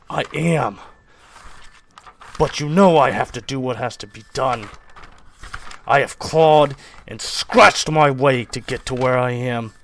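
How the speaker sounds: forceful, emphatic self-justification; loud, driven husband; menacing father. Acted. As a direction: insist to your wife, leaning hard on key words. Father answers in acted quarrel.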